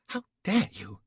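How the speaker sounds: high, loud, outraged lady; squealing outrage. A narrator's reading. Betty squeals at Flashman in outraged shock. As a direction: shriek it like an affronted young lady. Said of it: "she squealed".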